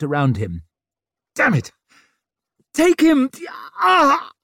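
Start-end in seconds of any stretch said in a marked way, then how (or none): 1.3-3.3 high, loud desperate command; pained urgent shout. A narrator's reading. Mother desperate to hand off baby, pained shouting. 3.8-4.3 very high, loud cry of agony; agonised pain cry. A narrator's reading. Mother in a stronger spasm, agonised cry. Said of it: "another spasm of pain".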